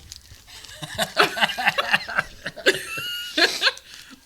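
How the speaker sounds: loud high laughter. Real, not acted. Laughing at own joke.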